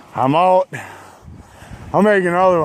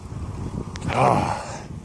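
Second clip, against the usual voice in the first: a soft, sinking sigh; defeated sigh; 6 semitones lower, 8 dB quieter than usual. Real, not acted. Cyclist seeing yet another hill, defeated sigh. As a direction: deflate at the sight of the hill.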